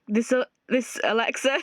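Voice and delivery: broken laughing sob; unhinged cackle; crazed laugh. Acted. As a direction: crack into manic laughter. Laughing crying breakdown.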